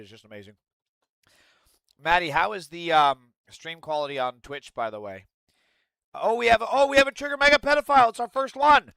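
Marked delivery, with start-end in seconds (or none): 6.1-8.9 high, loud mock delight; excited. Real, not acted. Streamer reacting to a troll donation, high and loud. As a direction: greet it like a prize.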